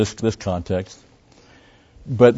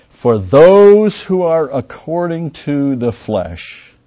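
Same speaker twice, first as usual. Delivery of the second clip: very slow, high, loud verse; very slow loud scripture; emphatic reading; 5 semitones higher, 5 dB louder, much slower than usual. Acted. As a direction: stretch every word out loud.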